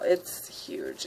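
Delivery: "huge" dropped low and heavy; deep voice. Shown as a demonstration. Repeats "huge" in varied ways.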